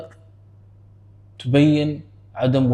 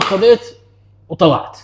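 higher, louder burst of retold glee; mock excited patient; 6 semitones higher, 5 dB louder than usual. Patient boasting to the doctor, higher and louder. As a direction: play the patient bragging he walked out.

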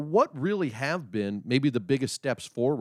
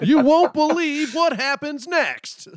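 slow, much higher, louder, laughing teaser; clickbait video narrator; 6 dB louder, slower than usual. Pretend. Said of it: "clickbait title".

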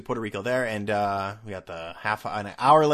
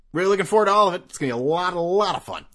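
higher, louder fake cheer; sarcastic enthusiasm; 7 semitones higher, 6 dB louder, faster than usual. Traveler dreading a 3am arrival, sarcastically cheery. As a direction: gush while meaning the opposite.

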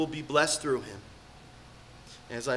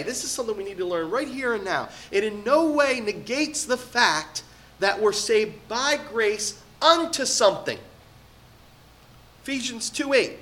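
higher, louder impassioned sermon; preaching at full force; 7 semitones higher, 4 dB louder than usual. Acted. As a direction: preach it with rising urgency. Emphatic preaching section.

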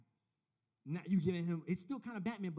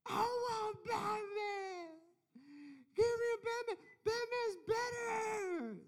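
put-on crying child; much slower than usual. Son crying over the Batman toy.

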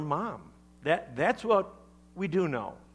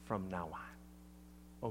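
soft whisper; 6 semitones lower, 10 dB quieter, slower than usual. Retold or imitated. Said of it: "in a whisper".

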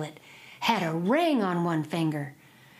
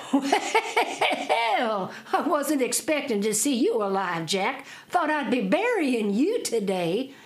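hollering over noise; 5 semitones higher, slower than usual. Acted. Miller hollers over the mill.

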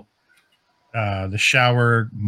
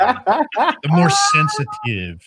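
drawn-out, suggestive and slow; 6 semitones higher, much slower than usual. Acted. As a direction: stretch it out with innuendo. Innuendo about sensitive areas.

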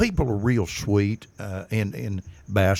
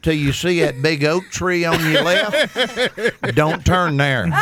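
Texan giving directions; 6 semitones higher than usual. Retold or imitated. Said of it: "and the guy goes".

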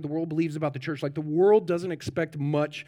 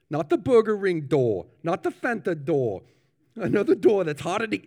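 higher, louder New Zealand minister; New Zealand accent; 6 semitones higher, 6 dB louder than usual. Acted. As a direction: preach as an inept New Zealand funeral minister, pitched up. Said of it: "I am gonna attempt, uh, the accent".

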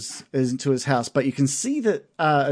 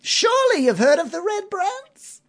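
shopkeeper voice; 4 dB louder than usual. Acted. Merchant haggling with adventurers over a pen, high put-on shopkeeper voice. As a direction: play the sly merchant squeezing a customer.

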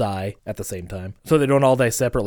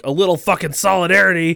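exasperated; 7 semitones higher, 7 dB louder than usual. Retold or imitated. Character's exasperated demand retold.

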